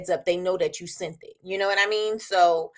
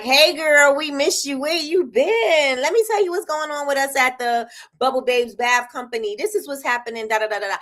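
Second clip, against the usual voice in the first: casual brand voice; 5 semitones higher, 3 dB louder than usual. Shown as a demonstration. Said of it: "put it in your voice".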